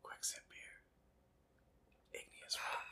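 breathy ASMR whisper. Pretend. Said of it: "Geology A S M R".

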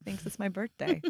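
soft, slow, deflated retelling; acting out quiet disappointment; sad realization. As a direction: let it sink in sadly, quietly and slowly. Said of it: "it made me sad going".

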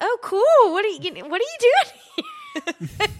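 very high, loud forced cheer; hammed-up awkward enthusiasm; mock casual surprise. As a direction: overplay the friendly question, cracking up. Retold awkward greeting to surprise nanny.

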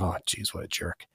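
soft inner grumble; muttered thought. Retold or imitated. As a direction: mutter it under your breath. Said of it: "I saw a guy and I was like".

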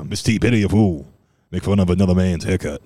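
quick, lightly put-on tough-guy voice; a hint of a trash-talking character, fast; Mister T. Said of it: "why I'm talking like Mister T".

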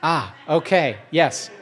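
ordinary talk

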